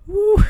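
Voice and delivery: high, loud "woo"; excited whoop. Real, not acted. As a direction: let out a high whoop at a wild figure. Reacting to a crazy projection.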